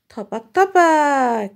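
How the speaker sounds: slow drawn-out thapak thapak; slow frog hops. Shown as a demonstration. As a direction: act out the frog hopping slowly for the child. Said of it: "ব্যাং কেমন লাফায়?". (ধীরে ব্যাঙের লাফের শব্দ)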